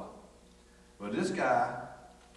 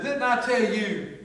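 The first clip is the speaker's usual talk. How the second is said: slow, high, loud scolding yell, imitating him; hammed-up furious inspector shouting; mad fire marshal; 13 semitones higher, 10 dB louder, slower than usual. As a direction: mimic the angry fire marshal dressing him down. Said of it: "He was so mad at me".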